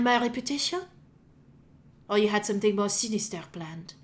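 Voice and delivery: deliberate, hushed cleric interrogating; archbishop. Acted. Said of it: "And Archbishop nods, looks down, and says".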